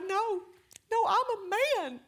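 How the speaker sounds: high, loudish tearful impression; mock weeping. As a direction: wail it like an overcome husband. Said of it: "he came out of the bedroom weeping".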